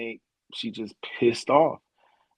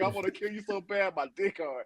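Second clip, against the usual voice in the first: [name] impression; 7 semitones higher, 7 dB quieter than usual. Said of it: "motherfucking [name] from New Jack City".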